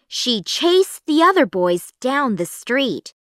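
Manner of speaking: plain reading voice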